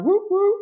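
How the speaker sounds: high-pitched double "woo"; celebratory whoop. Real, not acted. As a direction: cheer the viewer with high woos. Cheering a viewer during shout out.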